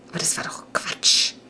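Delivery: a hushed aside to yourself; whisper. A narrator's reading. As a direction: breathe it as a secret thought. Doubting thought whispered.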